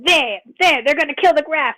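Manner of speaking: loud, high mock alarm; imitated alarmed cry. Player throwing a teammate's words back at them, mock alarm. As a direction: cry it out, imitating a panicked tattler. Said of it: "You were like".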